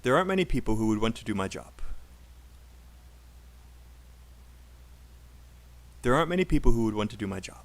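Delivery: fast linked sentence with "who would" and "to do" tiny; unstressed function words. Shown as a demonstration. Teacher modelling for students to repeat, fast reduced words. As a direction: rattle it off, shrinking "who would" and "to do my". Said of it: "all of these words are unstressed".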